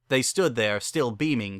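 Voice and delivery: plain reading voice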